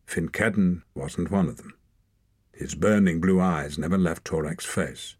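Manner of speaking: ordinary delivery, nothing marked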